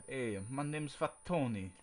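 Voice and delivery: slow, lightly put-on Italian mobster accent. Podcast host quoting the cartoon mobster, slow Italian accent. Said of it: "the Italian mobster".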